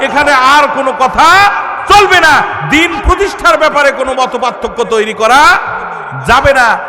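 loud, forceful prohibition; scolding tone. Acted. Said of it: "ধমকের সুরে কথাটা বলছেন".

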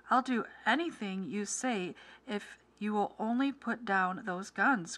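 ordinary delivery

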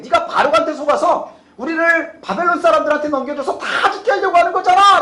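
high, rising scolding, loud at the end; angry accusation. Acted. Israelites accusing the prophet of betraying them, scolding angrily. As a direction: build to a heated, high-pitched accusation. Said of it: "호통을 칩니다".

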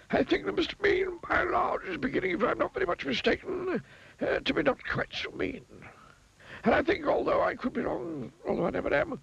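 a high wizard delighted with himself; pleased wizard. A narrator's reading. Wizard musing that the miser has learned.

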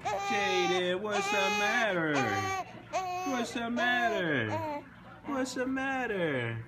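dad cooing to his crying newborn. Real, not acted. Soothing crying baby.

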